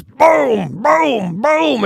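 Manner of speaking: imitating a shotgun blasting; shotgun booms. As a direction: fire off the shotgun with your mouth. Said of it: "takes their shotgun out and goes".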